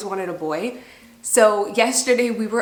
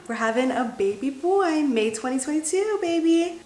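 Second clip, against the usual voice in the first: rising higher and higher with joy; excited announcement; 7 semitones higher than usual. Real, not acted. To her viewers, revealing it's a boy, rising excitedly. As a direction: sing out the big news, climbing up.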